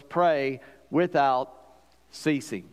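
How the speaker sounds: slow, spaced-out verse with pauses; deliberate quotation. Acted. Preacher quotes a very simple verse, slow and deliberate. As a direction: give each of the three short words its own space.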